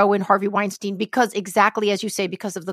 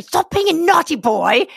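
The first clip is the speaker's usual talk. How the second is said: lightly Australian, higher telling-off; a hint of an Australian nanny scolding; 8 semitones higher, 3 dB louder than usual. Co-host echoing the actress in a mild Australian accent, light scolding. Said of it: "I think she's Australian".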